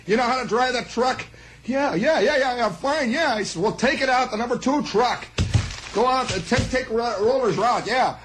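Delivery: high brisk exchange; boss and eager kid. Retold or imitated. Mister Moss sending the clerk out, high eager back and forth. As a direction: rattle off the yeahs eagerly.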